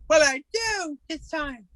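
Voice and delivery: high, drawn-out tearful wail; crying. Acted. Orphan crying as the sheriff takes him away, high tearful wail. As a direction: cry it out slowly, pitched well up high. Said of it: "And I was crying".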